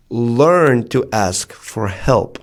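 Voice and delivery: learn to ask for help, drawn out slow and deliberate; slow emphatic plea. Real, not acted. A speaker urging his audience to ask for help, slow and emphatic. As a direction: lean on every word, slowly.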